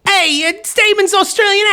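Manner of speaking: put-on Australian accent slipping toward a New York gangster; mock Australian accent. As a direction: try an Aussie voice that drifts into Goodfellas. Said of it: "I actually am slipping into Goodfellas".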